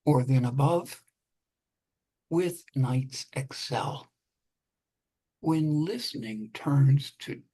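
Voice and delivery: slow measured poetry reading; poem reading. Acted. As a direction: read it like a poet, unhurried. Poet reads own poem.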